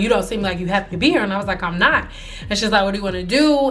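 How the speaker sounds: ordinary talk, nothing marked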